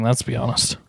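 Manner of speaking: unvoiced, soft aside; whisper. Real, not acted. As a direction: murmur it behind a hand.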